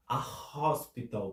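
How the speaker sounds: A HOSPITAL with a breathed H after A; aspirated H. Shown as a demonstration. Teacher repeating for the H sound, aspirated H. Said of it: "porque tem esse som do H".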